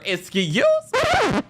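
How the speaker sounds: very high, drawn-out imitation; mock outrage. Adriana confronting Kiki, mimicked outrage. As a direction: stretch it into a high-pitched, affronted squeal.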